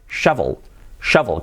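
shovel as SHA-vol, clear English v; pronunciación correcta. Shown as a demonstration. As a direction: say "shovel" with a short open 'sha' and marked v. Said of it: "Cambia mucho decir shovel a shovel". (shovel con la uve bien marcada)

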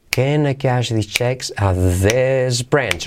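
drawn-out, word-by-word demo; slow model phrase. Shown as a demonstration. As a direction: stretch the phrase out for learners.

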